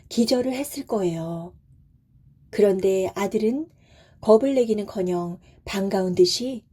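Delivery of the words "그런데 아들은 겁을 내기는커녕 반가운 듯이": plain reading voice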